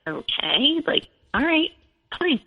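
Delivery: retold wary acquiescence; skeptical acceptance. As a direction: go along with it doubtfully. Wary reply to husband's excuse.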